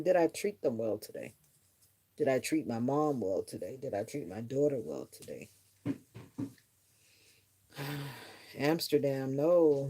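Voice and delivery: normal talking voice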